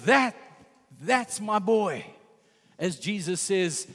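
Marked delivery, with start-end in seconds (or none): 0.0-2.0 proud God voice. Retold or imitated. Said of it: "God says".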